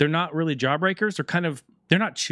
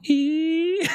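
nose whistle squeak. Retold or imitated.

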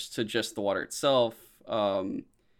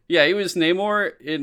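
ordinary talk

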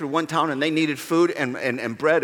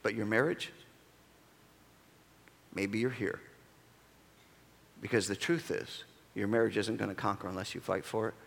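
much softer, lower and sincere; quiet earnest appeal; 9 semitones lower, 14 dB quieter than usual. Acted. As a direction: lean in and speak to each heart softly.

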